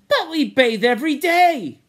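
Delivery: high, loud protest. A narrator's reading. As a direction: object loudly, voice shooting up. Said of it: "gulped down a mouthful and protested".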